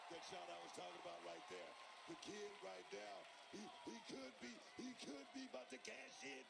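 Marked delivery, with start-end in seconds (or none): 2.1-6.4 rising, higher excited call; wrestling commentator. Real, not acted. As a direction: build the hype toward the finish.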